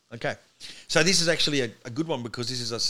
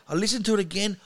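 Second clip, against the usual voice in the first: usual speaking voice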